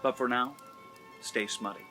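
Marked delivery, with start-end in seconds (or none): none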